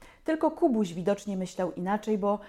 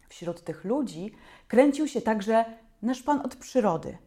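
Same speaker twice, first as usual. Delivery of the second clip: plain reading voice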